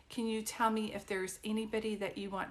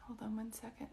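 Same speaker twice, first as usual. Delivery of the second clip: quiet aside; 9 dB quieter than usual. Real, not acted. Medium pauses reading, half aside.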